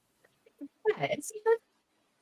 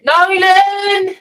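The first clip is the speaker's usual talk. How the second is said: calling out; 9 semitones higher, 8 dB louder, much slower than usual. Acted. Calling for missing Nolan.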